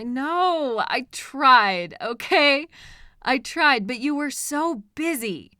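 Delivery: loud, pleading insistence; defensive outburst. Acted. Captor loudly insisting to captive that they tried. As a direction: burst out defending yourself.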